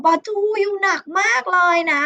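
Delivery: lightly acted moan to a friend; mild complaint. A narrator's reading. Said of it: "ดูบ่นนะคะ".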